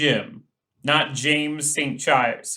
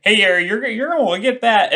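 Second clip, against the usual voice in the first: calling out; 6 semitones higher, 5 dB louder than usual. Acted. Player voices character handing stick.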